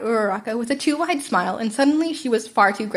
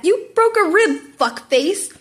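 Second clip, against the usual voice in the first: higher, louder, slow pained shout; 8 semitones higher, 5 dB louder, much slower than usual. A narrator's reading. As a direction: drag out the outrage at the broken rib. Injured fighter shouting at rival.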